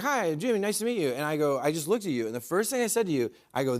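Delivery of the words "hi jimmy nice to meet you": lightly imitated host greeting, higher pitch; a touch of the host introducing himself; Jimmy. Comedian retelling their first meeting, lightly voiced host. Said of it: "he was like".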